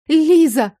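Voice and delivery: loud, high sudden cry; yelling. A narrator's reading. Patient suddenly remembering who hurt her, yelling. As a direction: blurt the name at full voice. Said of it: "заорала я".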